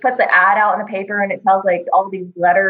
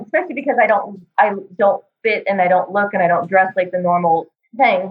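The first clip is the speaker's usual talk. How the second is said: usual speaking voice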